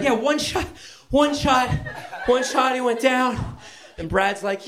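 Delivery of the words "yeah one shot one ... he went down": very high, proud, laughing repeat; giddy high boasting; excited. Retold or imitated. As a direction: crow it with delight. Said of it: "And I was like".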